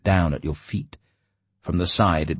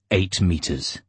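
ordinary delivery

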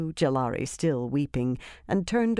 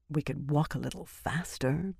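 muttered; 5 dB quieter than usual. A narrator's reading. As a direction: mutter it softly under your breath. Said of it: "Birgitta muttered".

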